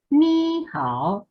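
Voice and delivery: "Ni hao" starting high on "Ni"; wrong high tune. Shown as a demonstration. Teacher contrasts the wrong melody, high "Ni". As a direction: pitch "Ni" high, as a wrong example. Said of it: "No es".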